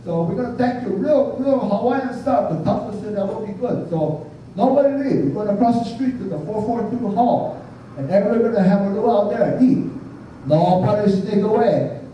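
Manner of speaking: high, lively Hawaiian pidgin with a tag "yeah?"; Hawaiian pidgin announcement. Retold or imitated. Priest inviting mourners to the luau in Hawaiian pidgin, high. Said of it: "I said".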